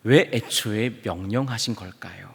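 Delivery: ordinary talk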